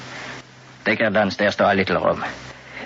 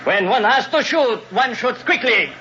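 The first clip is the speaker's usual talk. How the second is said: sharp raised voice. Acted. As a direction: call it out so others hear. Captain fakes shooting the prisoner.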